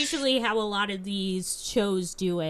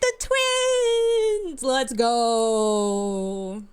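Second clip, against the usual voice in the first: loud, high drawn-out cheer; real fan-style celebration yell; excited shouting; 13 semitones higher, 7 dB louder, much slower than usual. Real, not acted. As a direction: whoop with real hype, stretching the words. Player cheers as the twins appear.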